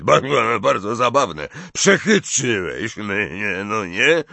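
loud, stammering gruff giant; irritated, growly character voice; growled grumbling. A narrator's reading. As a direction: grudgingly give in, slowing at the end. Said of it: "warknął Hagrid".

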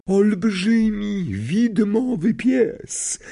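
high, slow, doom-laden seer voice; ominous seer. A narrator's reading. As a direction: intone the omen with dread. Trelawney describes the Grim.

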